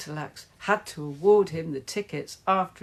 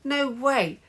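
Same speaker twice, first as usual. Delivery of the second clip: high-pitched cry of protest; screamed denial; 8 semitones higher, 3 dB louder than usual. A narrator's reading. Player denying a handball, high scream. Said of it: "screamed Aerobic Owl".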